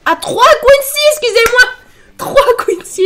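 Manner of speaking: high, laughing self-correction. Real, not acted. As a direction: giggle through fixing the count. Laughing at own miscount.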